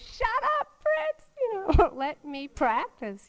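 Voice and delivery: very high exasperated shout of shut up, slowing down; mock shout at the dog. Meditator at retreat, hammed-up mock shout at the dog. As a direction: yell at the barking dog in mock fury. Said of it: "all I wanted to go was".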